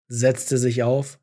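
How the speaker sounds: ordinary talk, nothing marked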